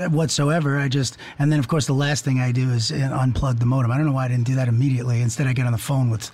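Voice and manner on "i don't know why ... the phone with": ordinary delivery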